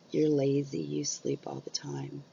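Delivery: slow, mocking dismissive jab with a laugh, ending in a whisper; mocking people. Mimicking people who call her lazy, mocking. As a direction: sneer it lightly, then drop to a whisper. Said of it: "they're like".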